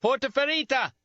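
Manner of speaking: very high, loud call for help; loud cupped-hands yell; shouting. Acted. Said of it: "I shouted".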